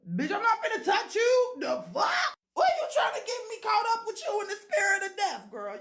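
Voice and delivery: high, loud panicked yelling; panicked shout. Retold or imitated. Refusing the ghostly roommate, a high panicked shout. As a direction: scream at her not to touch you.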